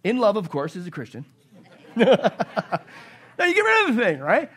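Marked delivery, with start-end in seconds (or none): 1.9-2.8 a short burst of laughter at his own joke; real laugh. Real, not acted. 3.4-4.5 laughing. Real, not acted. Laughing after spider joke.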